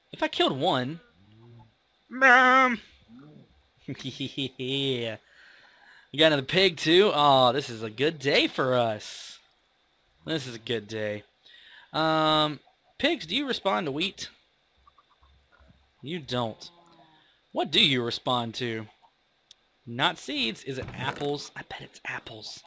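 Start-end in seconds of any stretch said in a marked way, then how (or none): 2.1-2.8 loud high call across the house. Real, not acted. Gamer calls out to his mom mid-recording, loud. Calling out to mother. 21.5-22.6 whispered guess. Acted. Player guessing about pigs, whispering.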